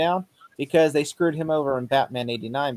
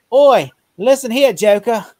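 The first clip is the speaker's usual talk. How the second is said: hammed-up Cockney "Oi", much higher, much louder and slow; mock British accent; 7 semitones higher, 9 dB louder, slower than usual. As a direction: bark it like a cartoon London cop. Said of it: "couldn't do a British accent".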